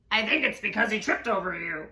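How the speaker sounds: ordinary delivery, nothing marked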